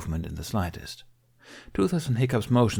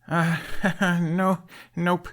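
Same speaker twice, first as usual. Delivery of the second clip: weak chuckle; 10 semitones higher, much slower than usual. A narrator's reading. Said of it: "Hiccup chuckled weakly".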